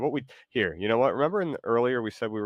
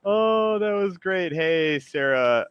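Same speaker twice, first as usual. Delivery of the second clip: excited; 11 semitones higher, 9 dB louder, much slower than usual. Real, not acted. Streamer reacts to the performance.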